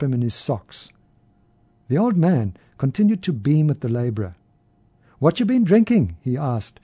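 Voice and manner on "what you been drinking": curious. A narrator's reading. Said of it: "his eyes sparkling with interest".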